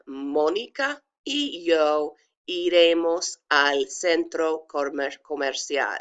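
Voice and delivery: slow, careful Spanish; pronunciation demo. Shown as a demonstration. Teacher repeating the sentence, slow careful demo. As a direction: spell out by ear "Mónica y yo iremos al centro comercial", unhurried. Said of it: "pronounce them for you".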